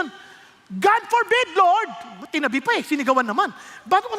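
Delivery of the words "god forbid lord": sharp exclamation; God forbid exclaimed high. Acted. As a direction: cry it out as a protest. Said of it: "Exclamation point to".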